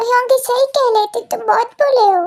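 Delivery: put-on high, fast, scared young voice; a shaken boy pulling back in fear; frightened Kookie. (डरा हुआ, ऊँची आवाज़ में)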